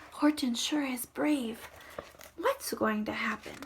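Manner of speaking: worried whisper; hushed suspense. Real, not acted. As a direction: lean in and whisper the question. Suspense aside.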